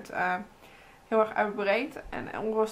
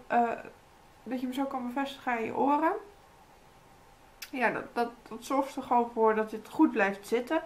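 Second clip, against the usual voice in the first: lips held by wet sheet; limited lip movement; talking with face mask on. Real, not acted.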